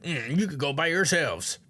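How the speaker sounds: lightly voiced sulky grump; slow, dismissive brush-off in a lightly put-on voice; an angry alien dismissing the others, mildly sulky; grumpy character voice. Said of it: "angry guy is like".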